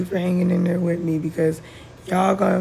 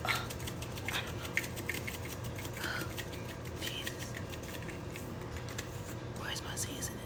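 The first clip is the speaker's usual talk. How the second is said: whisper; 17 dB quieter than usual. Real, not acted. Voice failing, whispering to herself.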